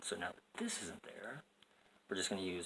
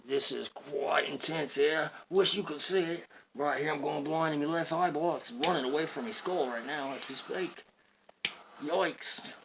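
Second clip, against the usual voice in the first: loud, higher mock Australian accent; hammed-up Australian excitement; 7 semitones higher, 8 dB louder than usual. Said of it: "I go straight to Australian".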